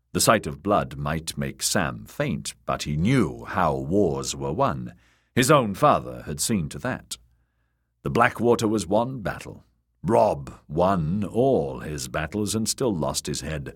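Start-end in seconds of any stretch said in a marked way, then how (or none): none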